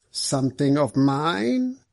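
slow, questioning character voice; unnaturally slow. A narrator's reading. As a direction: draw the question out a little too long. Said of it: "a little more slowly than was quite natural".

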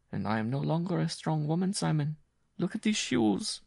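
a worn-out woman telling her hardship; Jean's voice. Acted.

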